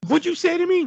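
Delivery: high, loud mock outrage; hammed-up confrontational question; outraged challenge. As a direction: imitate an offended partner demanding an answer. Acted partner challenges the retort.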